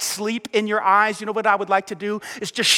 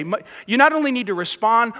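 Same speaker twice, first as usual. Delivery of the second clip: ordinary delivery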